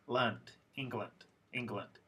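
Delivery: "England" ending in a reduced "lund"; unstressed, quick uh in the last syllable of "England"; schwa in England. Shown as a demonstration. Teacher correcting the spelling guess, "England" said as ING-glund. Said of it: "but there's a schwa there".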